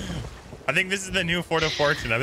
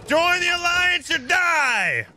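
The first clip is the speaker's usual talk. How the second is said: high, slow, drawn-out shout; shouted ultimatum; 4 dB louder, much slower than usual. Pretend. Demanding the other ship join the alliance, shouting. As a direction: bellow it slowly across the water.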